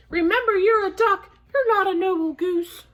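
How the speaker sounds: indignant goose. A narrator's reading. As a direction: reprimand as an affronted goose. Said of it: "She flapped her wings indignantly".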